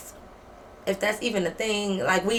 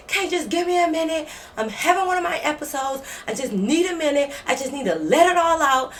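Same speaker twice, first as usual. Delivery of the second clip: high, overwrought roommate imitation; mock worked-up plea; 6 semitones higher than usual. Roommate in an episode begging for a minute, high and overwrought.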